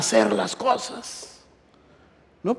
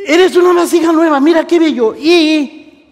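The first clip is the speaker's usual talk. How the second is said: high, loud preacher's cry; exuberant exclamation; 11 semitones higher, 9 dB louder, slower than usual. Real, not acted. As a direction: proclaim it high and at full voice. Exclaims over the new vessel.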